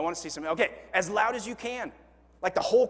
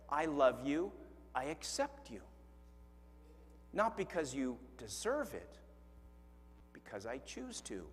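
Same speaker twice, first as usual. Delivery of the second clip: soft, gentle, fading words of love; tender voice of God; 5 semitones lower, 10 dB quieter than usual. Acted. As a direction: speak God's love to them softly. God speaking tenderly to listener.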